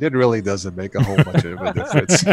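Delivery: ordinary talk, nothing marked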